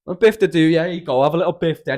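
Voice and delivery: loud, mocking singsong tease; teasing joke. Teasing a sleeping mate, a mock taunt. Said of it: "I'd crack a joke".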